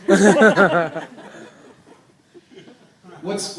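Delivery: normal talking voice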